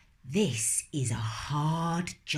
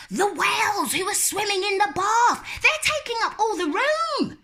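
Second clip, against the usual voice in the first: put-on loud little girl; 4 dB louder, faster than usual. Lola protesting about whales, loud. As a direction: protest at the top of your voice.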